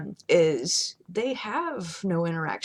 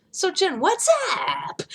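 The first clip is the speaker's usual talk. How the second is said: mock visitor greeting; 11 semitones higher, 3 dB louder, slower than usual. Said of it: "you come over the house and you're like".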